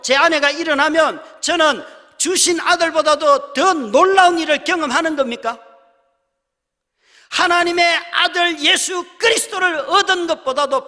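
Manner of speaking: high, loud preaching climax; preaching at full force. Real, not acted. Preacher challenges the congregation, shouting high. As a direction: cry it out to the congregation. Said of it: "preaching climax".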